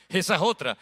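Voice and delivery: said through a chuckle; amused repeat with a laugh. Real, not acted.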